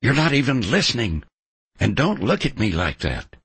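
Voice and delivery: sharp, quick angry reproach. A narrator's reading. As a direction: scold him, fast and irritated. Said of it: "she was angry now".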